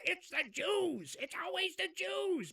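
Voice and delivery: hammed-up mocking parrot; mocking Iran. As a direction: imitate the tired old refrain, slowly. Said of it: "I'm so tired of the".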